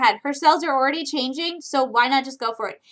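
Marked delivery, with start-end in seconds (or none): none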